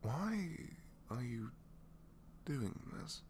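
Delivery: high, halting disbelief; bewildered question. Acted. Villain offered an olive branch by a hero, bewildered. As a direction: ask it haltingly, pitched well up.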